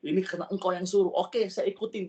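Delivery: exasperated agreement, pushed out quickly; forced compliance. Retold or imitated. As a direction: give in under pressure, emphatically and fast. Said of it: "kayak dipaksa".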